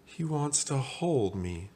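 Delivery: slow, high, smiling sigh; high, dreamy contentment; blissful cooing. Acted. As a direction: coo it slowly with a smile. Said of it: "closed his eyes and smiled".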